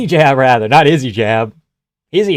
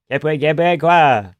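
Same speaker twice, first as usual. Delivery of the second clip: put-on Quebec French accent. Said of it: "Is that a Quebec accent?".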